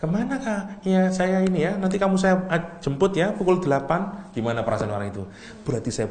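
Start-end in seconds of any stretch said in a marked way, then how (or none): 0.0-4.3 high, lively acted dialogue; high-pitched, keen arranging of a pickup; eager invitation. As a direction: play out a friendly invite, pitched well up. Acted invitation between two people.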